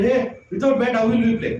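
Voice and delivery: mock a friend's protest.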